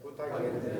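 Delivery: 부탁인데 whispered softly with a falling end. Shown as a demonstration. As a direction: breathe 부탁인데 quietly. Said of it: "저 따라하세요". (부탁인데를 속삭이듯 내리며)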